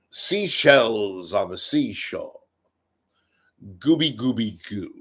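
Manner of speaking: drawn-out, mock-elegant British accent. Shown as a demonstration. Comic demonstrating British against normal, British accent. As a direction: make nonsense sound British and persuasive. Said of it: "you said it in a British accent".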